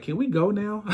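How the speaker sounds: imitating son. Son upset at the movie.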